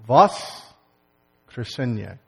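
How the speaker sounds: Voskresenia stretched out very slowly and loud; slow, loud final. Shown as a demonstration. Pastor closing the Russian word lesson with a long slow call. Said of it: "I'm gonna say it slowly".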